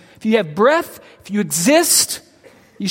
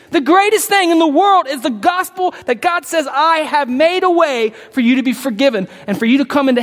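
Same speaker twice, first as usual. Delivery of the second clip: the preacher at full force; fervent preaching climax; 7 semitones higher, 3 dB louder than usual. Real, not acted. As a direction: cry out eternity to the congregation.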